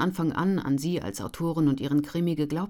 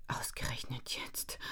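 inner thought; 3 semitones lower, 12 dB quieter than usual. A narrator's reading. Ellen's bitter inner thought.

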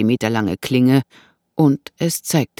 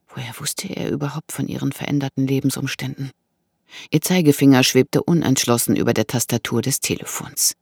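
usual speaking voice

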